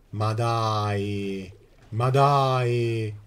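loud exasperated groan; exasperated, rising to a shout; emphatic italian speech. Real, not acted. Frustrated game exclamation.

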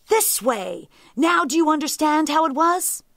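exasperated tiger, rising high and loud; out of patience. A narrator's reading. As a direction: build to a loud, impatient demand. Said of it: "the tiger lost his patience".

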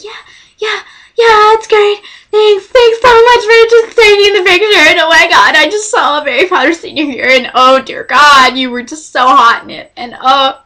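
high, excited babble easing down; retold starstruck rambling; gushing fangirl. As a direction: gush thanks in a giddy high voice. Gushing at idol after photo.

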